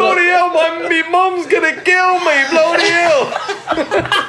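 very high, hammed-up British wail; mock British accent. Playing a wizard scared of mum, high mock British panic. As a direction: squeal like a panicking cartoon Brit.

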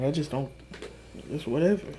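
ordinary talk, nothing marked

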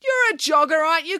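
high-pitched challenging call. A narrator's reading. Nanny calling after a walking jogger, high challenging call. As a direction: call after someone sharply. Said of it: "called Nanny Piggins".

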